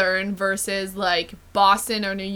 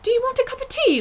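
very high-pitched posh British accent; faster than usual. Shown as a demonstration. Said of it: "whenever I go British ... gets higher".

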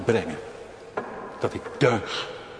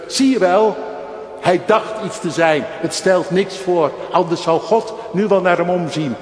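spottende menigte; 7 semitones higher, 7 dB louder than usual. Retold or imitated. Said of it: "riep iedereen".

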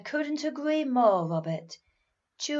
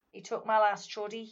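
put-on Manchester accent. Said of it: "if you are in Manchester and a child said".

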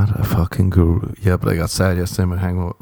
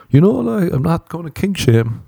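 mocking American phrase; 10 semitones higher than usual. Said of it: "People love saying that in America".

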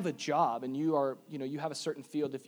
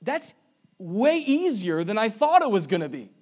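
mock surprise; 6 semitones higher, 9 dB louder than usual. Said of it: "you're a little like".

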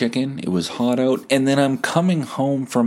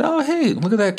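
put-on much higher baby-talk voice; cooing at a dog. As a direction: coo at a cute puppy.

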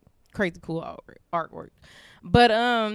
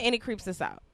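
normal talking voice

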